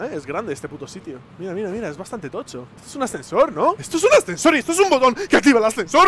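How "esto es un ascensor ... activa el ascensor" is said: burla. Real, not acted. Explica con tono burlón agudo.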